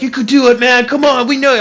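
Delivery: high, loud hyped-up encouragement; imitated cheering friends. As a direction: egg him on like a pumped-up buddy. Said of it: "They're like".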